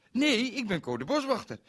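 squeaky owl answering; high creature voice. Acted. Owl's reply on phone.

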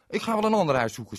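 loud, fast huffy; sulky boy. Acted. Berend tells himself he leaves, loud and fast. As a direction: storm off in a huff. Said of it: "zei hij tegen zichzelf".

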